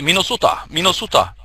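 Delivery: Oslo-dialect Minnesota, vowels rounded; Norwegian Minnesota. Shown as a demonstration. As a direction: say Minnesota the Norwegian way, mee-no-soo-ta. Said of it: "How do you say Minnesota in Norwegian?".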